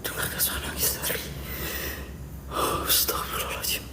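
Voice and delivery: hushed prayers and questions barely breathed; praying in a whisper, afraid of the crying voice; frightened whisper. Real, not acted. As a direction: keep it to a scared whisper throughout.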